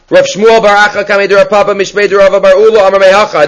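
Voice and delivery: high, level chanting read-out; sing-song Aramaic recitation; Talmud text recitation. Real, not acted.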